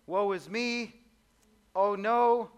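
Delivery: theatrale klaagtoon, hoger en luider op "Oh no"; mock lament. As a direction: speel overdreven wanhoop. Acting out human despair.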